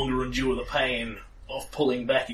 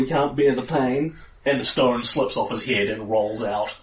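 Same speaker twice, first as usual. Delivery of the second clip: ordinary talk, nothing marked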